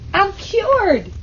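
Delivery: high joyful cry of relief; delighted. Acted. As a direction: burst out with relief and joy. Girl delighted at her cure.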